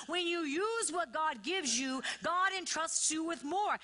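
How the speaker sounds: high, driving pulpit delivery; full-force preaching. Real, not acted. Preacher shows the church David's progression, full-force preaching.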